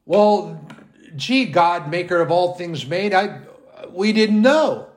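lightly voiced sheepish man. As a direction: stammer out a mock excuse. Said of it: "looks down at man".

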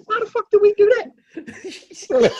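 high, slow, indignant quote; high mock outrage at a teammate; mock angry uncle. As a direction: play the outraged uncle, drawn out. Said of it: "he said".

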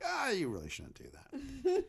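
lightly hammed-up romantic hero; teasing leading man. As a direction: drawl it out, flirting while you refuse. Voicing Peter in the retold scene.

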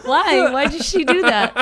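mock-offended Texan listener; mock Texan outrage. Texan guy voicing an offended Texan listener, loud mock outrage.

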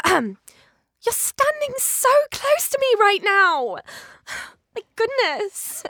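slow, high-pitched voice, indignant at the closeness; Claire from Fleabag. Shown as a demonstration. Actor doing Claire on the host's request, high and slow. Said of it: "Claire from Fleabag".

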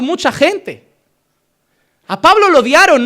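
predicación encendida, más alta y fuerte; predicación intensa. Acted. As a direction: predica con fuerza a la congregación. Predica con fuerza sobre Pablo. (predicador encendido ante la iglesia)

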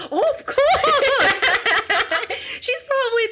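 gasp, then a high, loud burst of laughing agreement; real delight breaking into laughter; laughing exclamation. Real, not acted. Answering the host about her tiger mom, laughing excitedly.

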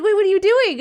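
high, loud, laughing disbelief; a half-laughing yelp of confusion; startled protest. Retold or imitated. Runner to the medal volunteer, high laughing disbelief.

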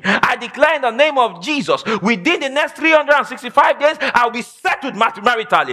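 high, chant-like preaching over music, building to a shout; fervent declaration. Acted. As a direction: proclaim it like a king, rising. Preaching declaration at full force.

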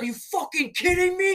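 high-pitched mock scream of outrage; imitated screaming. Teammate yelling after the ejection, high mock scream. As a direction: imitate someone screeching in disbelief, way up high. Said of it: "started, like, screaming, like".

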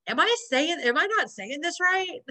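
high-pitched, baffled complaint; mock exasperation. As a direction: imitate someone not getting through. Retelling super's exasperation at being misunderstood.

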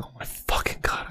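whispered in dismay. Retold or imitated. Realizing he spoiled the finale, whispered dismay. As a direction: breathe it out in horror. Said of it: "I said out loud".

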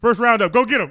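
very loud, high command; mock battle cry. Gun owner showing off a trick, mock loud command. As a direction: shout it like sending in troops.

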